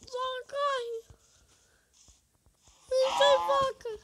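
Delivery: high panicked shouting; panicking gamer. Real, not acted. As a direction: yell in frantic panic. Panicking in the game.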